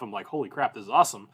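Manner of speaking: ordinary talk, nothing marked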